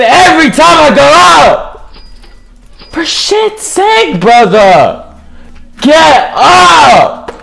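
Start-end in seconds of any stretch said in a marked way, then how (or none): 2.9-7.3 furious shout. Real, not acted. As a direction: bellow it out of frustration. Still raging, stuck on ground.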